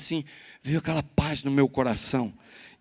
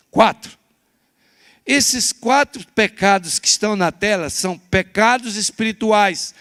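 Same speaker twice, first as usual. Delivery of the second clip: loud, high preaching voice, slowing on key words; full-force pulpit sermon; fiery preaching; 7 semitones higher, 7 dB louder than usual. Acted. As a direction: preach it out to the whole church.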